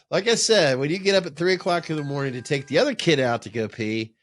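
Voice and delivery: ordinary talk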